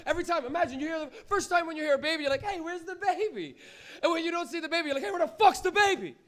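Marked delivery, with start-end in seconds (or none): 2.4-3.6 high, drawn-out puzzled question; mock bewildered commuter; puzzled passenger. Acts puzzled subway rider hearing baby. 5.0-6.2 high, loud baffled demand; mock alarmed commuter; alarmed passenger. Acts rider not seeing the baby.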